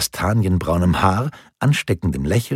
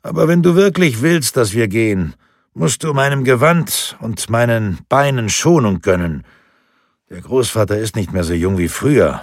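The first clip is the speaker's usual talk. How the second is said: higher, louder fond grandfather voice pleading gently; playful old grandfather; 5 semitones higher, 5 dB louder than usual. Acted. Grandfather asks grandson for patience with his old legs, fond pleading. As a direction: coax the boy with mock frailty.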